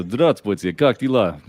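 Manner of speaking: Russian warm-up. Shown as a demonstration. Player getting into his accent, speaking Russian. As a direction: launch brightly into Russian pronunciation. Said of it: "speak a little bit of Russian to get into this".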